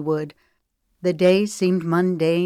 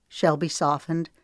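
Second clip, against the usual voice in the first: ordinary delivery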